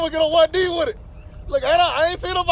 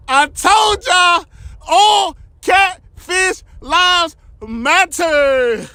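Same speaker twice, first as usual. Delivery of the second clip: triumphant shout; 2 semitones higher, much slower than usual. Real, not acted. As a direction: shout it out in victory. Triumphant over big catch.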